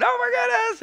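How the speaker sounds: high excited mock scream. Mom filming her son's home run, high excited squeal. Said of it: "she's screaming".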